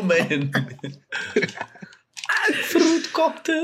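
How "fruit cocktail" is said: high, slow, whiny squeak; squeaky high voice. Pretend. Repeats the squeaky joke.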